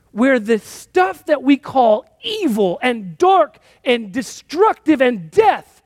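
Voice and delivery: impassioned preaching. Acted. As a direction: preach it slow and lifted, then come down. Climax of preaching, slow and raised.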